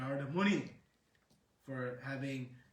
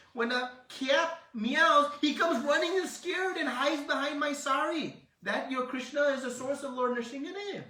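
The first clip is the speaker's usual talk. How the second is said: much higher and louder scoffing; mocking disbelief; 9 semitones higher, 7 dB louder than usual. Yashoda scoffing at Balaram's claim, high mocking voice. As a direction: ridicule the boast about the scared boy.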